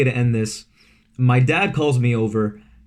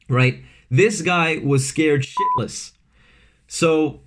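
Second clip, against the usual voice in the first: ordinary talk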